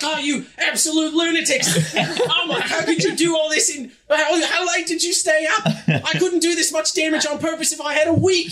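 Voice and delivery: high-pitched, hammed-up ranting in disbelief; outraged innkeeper. Innkeeper berating adventurers over the damage, outraged and despairing. [name] rants at the party.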